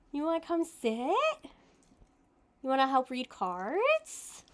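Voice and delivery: high sing-song voice for the cat; pet talk voice. Real, not acted. Talking to cat Tonks.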